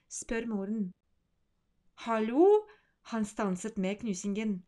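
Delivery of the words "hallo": incredulous hallo. Acted. Boy reacting in disbelief.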